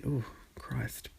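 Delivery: real soft whisper under the breath; a whispered groan at the aftertaste; whispered aside. Real, not acted. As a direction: mutter it to yourself in a whisper. Aside while drinking fizzy Fanta.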